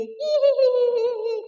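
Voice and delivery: high quick giggle; playful high giggle. Acted. Giggling after teasing about singing.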